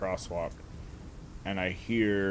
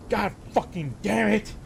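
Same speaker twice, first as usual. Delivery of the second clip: angry curse; 11 semitones higher, 3 dB louder than usual. Retold or imitated. Driver swears at spilled cup.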